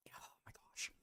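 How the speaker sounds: breathy whisper, barely there; whispered dismay. Real, not acted. A fan whispering in disbelief at a card.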